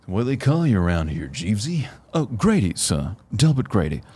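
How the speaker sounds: put-on light British accent, Shining butler; a hint of an English butler. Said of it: "your British accent's great".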